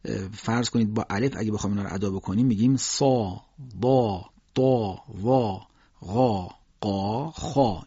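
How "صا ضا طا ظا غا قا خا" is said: slow, separate heavy syllables with a dark long "aa"; full-mouthed tafkhim demonstration; heavy letters. Shown as a demonstration. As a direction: say "صا، ضا، طا، ظا، غا، قا، خا" thick, back of the tongue raised.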